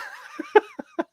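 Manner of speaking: sudden high squeal of laughter; real laugh. Real, not acted. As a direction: burst out laughing at your own pun. Laughing at own joke.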